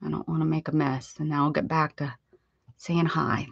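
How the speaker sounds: ordinary talk, nothing marked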